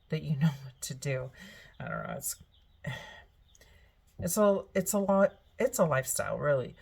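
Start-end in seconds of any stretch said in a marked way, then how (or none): none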